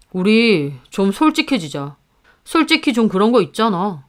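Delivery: lightly voiced husband, insinuating; a hint of a husband probing a sore spot; needling husband. Husband urging wife to admit envy, lightly voiced insinuation.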